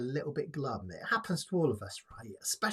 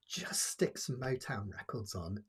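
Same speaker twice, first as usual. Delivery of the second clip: whispered, softer and lower; whispered tip; 5 semitones lower, 5 dB quieter than usual. Real, not acted. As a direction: whisper it like a secret remedy. Confiding tip to viewers.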